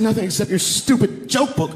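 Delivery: high, exasperated wife voice; imitated scornful wife. Wife to husband about empty box, imitated scorn. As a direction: spit out "stupid" in mock scorn.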